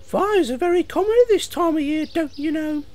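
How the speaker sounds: high-pitched know-all engine; loud, high little engine lecturing; Thomas. Acted. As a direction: inform in a high squeaky voice. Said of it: "said Thomas to the fire engine".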